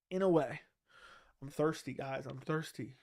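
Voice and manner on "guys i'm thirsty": ordinary talk, nothing marked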